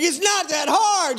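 high, slowed cheering call; hollering. Retold or imitated. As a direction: urge the child on with stretched words. Dad hollering to child overhead.

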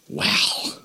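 breathless whispered wow; hushed wow. Shown as a demonstration. Preacher reacting to Paul's rebuke in a whisper. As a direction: let the wow fall out on a whisper. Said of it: "Gotta say it like that".

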